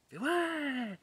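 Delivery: high, far-off jeering cry of a crowd; cheering crowd. Retold or imitated. Narrator mimics the arena crowd cheering at his screams, high jeer. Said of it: "la foule qui est en train de clamer".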